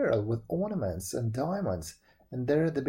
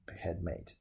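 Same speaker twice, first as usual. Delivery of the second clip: ordinary delivery, nothing marked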